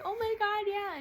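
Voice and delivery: high, loud excited gasp; excited sister. Retold or imitated. Sister reacting to the news, excited and high. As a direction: voice the sister's thrill.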